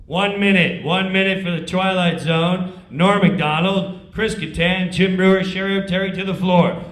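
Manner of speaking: loud, firm backstage call; stage manager calling cast to places. Retold or imitated.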